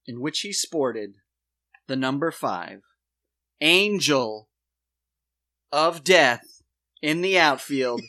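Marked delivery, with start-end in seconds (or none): 3.6-8.0 loud, weighty pronouncement; dramatic reveal. Real, not acted. Host unveils a clue to co-host, dramatic reveal. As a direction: land each phrase loudly, with pauses between.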